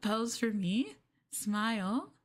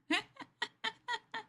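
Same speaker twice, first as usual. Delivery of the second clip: giggle; 12 semitones higher than usual. Acted. After getting too close.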